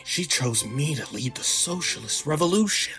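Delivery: softly put-on activist voice; quiet, hammed-up propagandist; mock activist. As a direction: send up a self-important activist. Mockingly voices an activist games presenter.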